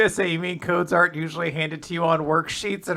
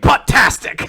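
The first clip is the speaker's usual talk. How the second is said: high, loud cheer; gleeful catchphrase; 8 semitones higher, 8 dB louder, slower than usual. Retold or imitated. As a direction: sell it like a wrestling gimmick. Character's catchphrase imagined.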